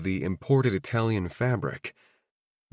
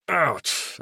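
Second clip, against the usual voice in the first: through gritted teeth; 6 semitones higher than usual. A narrator's reading. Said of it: "I grit through my teeth".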